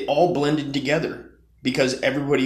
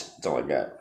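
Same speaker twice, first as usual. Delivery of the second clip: ordinary delivery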